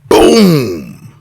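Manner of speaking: huge, loud drawn-out "boom"; explosive impact sound effect. Retold or imitated. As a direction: hit it like a sudden blow, falling off. Sound of the sudden headbutt.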